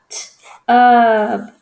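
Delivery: clipped "t" alone, then a loud, held "ub"; sounded-out word. Shown as a demonstration. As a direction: split "t" from "ub" with a gap. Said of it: "Like a".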